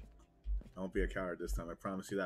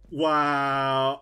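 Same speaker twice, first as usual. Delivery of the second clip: ordinary talk, nothing marked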